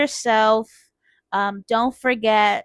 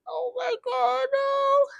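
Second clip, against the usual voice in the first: much higher, squeaky despair; mock dismay squeal; 4 dB quieter, slower than usual. Retelling her panic to viewers, high squeal. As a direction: squeal it like doom is here. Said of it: "I was like".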